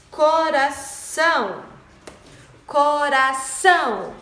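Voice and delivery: "coração" slowly split, ending on a strong nasal ÇÃO; drawn-out "coração", weight on the last syllable; stress on ÇÃO. Shown as a demonstration. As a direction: sound out "coração" loudly, syllable by syllable. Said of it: "O são".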